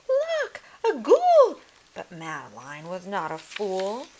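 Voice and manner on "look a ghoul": very high, loud scared scream; frightened shriek. Acted. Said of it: "The girls all cried".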